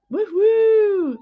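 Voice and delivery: high, delighted whoop at a friend; excited woohoo. Real, not acted. Greeting a friend who joined the stream, delighted whoop. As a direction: cheer her arrival. Cheering a friend joining live.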